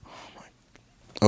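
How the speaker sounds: soft whispered dismay. Real, not acted. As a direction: breathe it out in dismay.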